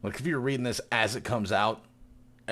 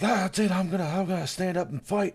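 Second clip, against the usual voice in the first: high-pitched mock resolve; a hint of a riled-up mayor; lightly voiced fed-up mayor; 9 semitones higher than usual. Retold mayor vowing to fight, mock defiant.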